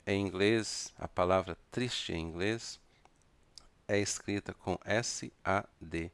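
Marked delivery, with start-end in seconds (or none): none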